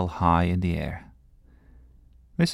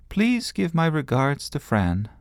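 high, lofty little princess voice; a girl pretending to be royalty; cartoon princess; 8 semitones higher than usual. A narrator's reading. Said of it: "Sophie decided that she would speak like a princess".

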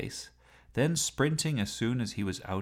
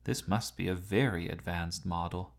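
usual speaking voice

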